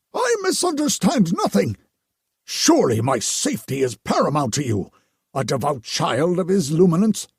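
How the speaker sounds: overly devout priest; pious priest voice. Acted. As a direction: gush with relief like a holy man. Narrator voices the Potter.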